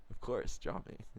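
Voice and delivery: soft, voiceless murmur; hushed aside. Acted. Man sitting there privately flattered by the drawing, hushed aside. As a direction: say it under the breath. Pretends calm while inwardly dismayed.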